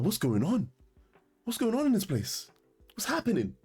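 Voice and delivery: imitating his own shock; mock alarm. To the waiter after the knife drop, mock alarm. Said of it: "I was like".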